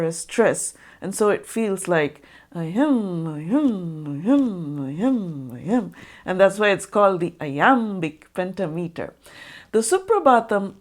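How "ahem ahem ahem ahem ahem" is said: "ahem" repeated with the second half stressed each time, lower and steady; da-DUM rhythm demo. Shown as a demonstration. Teacher sounding out the iambic beat for viewers. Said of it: "Every second sound gets a bit of a force".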